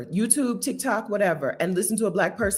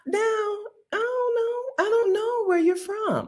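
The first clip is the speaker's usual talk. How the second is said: mock lament; 13 semitones higher, slower than usual. Lamenting accents she can't place.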